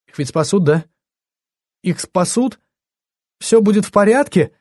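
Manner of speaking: high, fearful questions; anxious pleading. A narrator's reading. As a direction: beg for reassurance, voice climbing. Tearful woman begs for reassurance.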